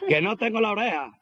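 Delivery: high, laughing mock panic; mock alarm. Worker realising his ear is gone, high mock panic. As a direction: gasp it out, half laughing. Man discovers missing ear.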